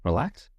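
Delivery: Relax with a gentle curious rise; curious question. Shown as a demonstration. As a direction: echo Relax back, curious. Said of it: "almost like a curious".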